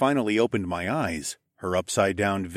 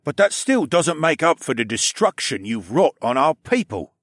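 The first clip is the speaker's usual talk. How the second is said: stern, louder captain voice; 4 semitones higher, 4 dB louder than usual. A narrator's reading. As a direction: scold like a stern uncle. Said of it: "in his deep voice".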